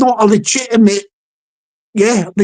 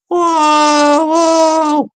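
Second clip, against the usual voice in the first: mock troll wail; 5 semitones higher than usual. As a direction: howl it like trolls everywhere. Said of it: "you'll hear it".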